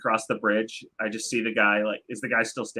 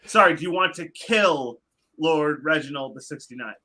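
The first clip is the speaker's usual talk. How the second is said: slow, deliberate restatement; careful correction; slower than usual. Acted. Adventurer corrects garbled request, slow and deliberate. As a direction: spell the request out slowly.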